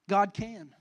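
ordinary delivery

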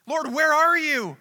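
high, loud cry of a desperate prayer; a sufferer crying out for comfort now, loud and pleading; desperate plea. Retold or imitated. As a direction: plead to God out of the pain, loud. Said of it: "we think".

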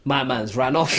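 usual speaking voice

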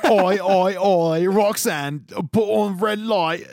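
loud, high Cockney singing call; mock Cockney. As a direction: chant it like a pub Cockney. Cockney [name] bit continues.

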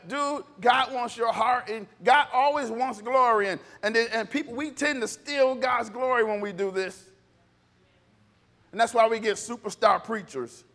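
usual speaking voice

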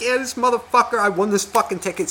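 a hint of a swearing braggart; cursing father. Father cursing in front of his kid, lightly mocked bragging. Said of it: "this is what this guy's doing".

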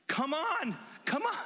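high-pitched, eager call; giddy, insistent coaxing; excited urging. Acted. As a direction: urge them along, voice jumping up. Acting giddy woman urging villagers.

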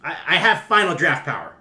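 loud stammering declaration. Acted. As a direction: blurt it out boldly. Writer asserts himself loudly.

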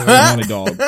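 loud, high, laughing correction; sudden loud correction with a laugh; blurted correction. Real, not acted.

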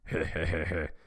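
Eggman's put-on evil chuckle; mock-evil "heheheh"; villain chuckle. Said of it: "And then he said".

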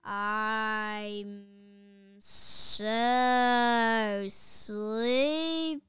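very slow, drawn-out sleepy mumble; hypnotized principal mumbling in a trance; mumbled. A narrator's reading. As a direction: drag the words out like you're half asleep. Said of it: "he mumbled".

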